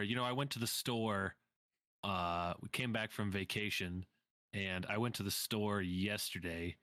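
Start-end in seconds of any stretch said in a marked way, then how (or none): none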